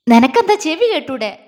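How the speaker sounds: loud exasperated snap; loud irritated retort; angry outburst. A narrator's reading. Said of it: "Meenu ദേഷ്യം കൊണ്ട് വിറച്ചു".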